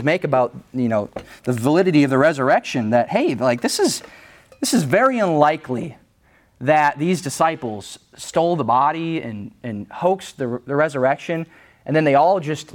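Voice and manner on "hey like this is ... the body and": ordinary delivery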